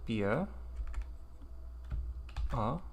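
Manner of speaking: ordinary delivery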